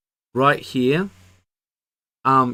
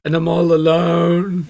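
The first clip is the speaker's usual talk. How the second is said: whiny, higher and louder, poor me; mock self-pity; 7 semitones higher, 9 dB louder than usual. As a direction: playfully pity yourself. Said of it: "with my sick voice".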